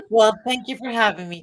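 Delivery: laughing. Real, not acted. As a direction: thank them while the laugh spills over. Laughs after unmute trouble.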